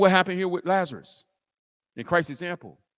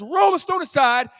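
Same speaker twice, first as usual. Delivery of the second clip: higher, mildly angry order; mad command; 6 semitones higher, 3 dB louder than usual. Acted. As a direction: order it with a touch of anger. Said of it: "Christ is mad".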